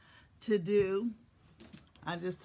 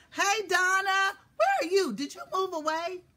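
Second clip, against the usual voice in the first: calling out; 13 semitones higher, 10 dB louder than usual. Real, not acted. Calling out to a viewer.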